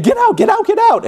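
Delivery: high, urgent repeated get out; urgent shouting. Acted. Egyptians hurry the people out, shouting high. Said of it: "everybody said".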